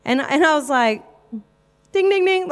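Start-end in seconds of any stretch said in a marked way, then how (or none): 1.9-2.5 high-pitched mock bell going off; excited inner alarm. Inner reaction to a mum asking about Jesus, excited alarm. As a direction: ring it out like a jackpot bell.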